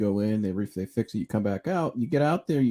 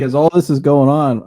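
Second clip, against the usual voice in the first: ordinary talk, nothing marked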